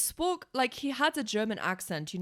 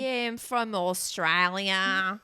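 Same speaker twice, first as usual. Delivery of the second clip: slow, drawn-out Aussie impersonation; fake Australian accent; 4 dB louder, much slower than usual. Retelling a prank on a stranger, fake Australian accent. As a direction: stretch it out in mock Australian. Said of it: "doing an Australian accent".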